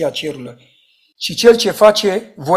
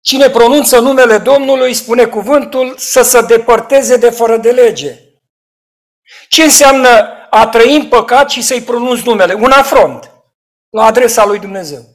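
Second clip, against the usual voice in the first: loud, high sermon warning; rising preacher's rebuke; fiery preaching; 7 semitones higher, 10 dB louder than usual. Acted. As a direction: thunder it from the pulpit. Preaching at full force.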